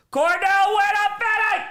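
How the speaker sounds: high, loud, slow announcer shout; big hyped call-out; hype shout. Real, not acted. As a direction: belt it like an arena announcer.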